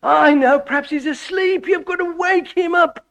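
hammed-up mocking prophet; mocking taunt. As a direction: sneer at the prophets in a high jeer. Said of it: "Can you see Elijah doing that?".

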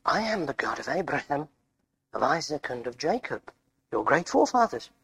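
low, soft and measured; solemn voice of God. Acted. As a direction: quote the divine voice in a hushed low register. Moses quotes God from the bush.

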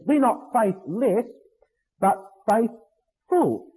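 slow, faithless held up, a pause, then FAITHFUL spaced out; contrast on faithful. Shown as a demonstration. Preacher explaining the word believing, slow stressed contrast. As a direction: set "faithless" against "faithful", stretching faith-ful in two parts. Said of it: "as the word really means, faithful".

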